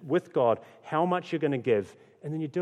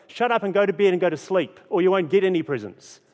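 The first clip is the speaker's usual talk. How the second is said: louder, irritated dad scolding; grumpy snap; 8 semitones higher, 10 dB louder than usual. Retold or imitated. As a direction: bark at the kids to go to bed. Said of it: "I get grumpy".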